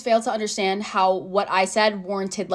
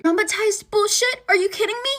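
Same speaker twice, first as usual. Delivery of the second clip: much higher, disbelieving questions; incredulous echo; 9 semitones higher than usual. Acted. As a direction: repeat it as if affronted. Incredulous echo of friend's words.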